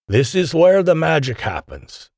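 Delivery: proudly drawn out, pitched high; proud announcement. A narrator's reading. Scientist showing newcomers the lab, proud. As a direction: announce it with slow, swelling pride. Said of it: "Doctor Kozlov proudly announced".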